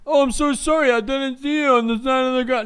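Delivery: high, sing-song fake-innocent apology; mock apology. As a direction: mimic a rival pretending he's sorry. Mocking the teammate's fake apology.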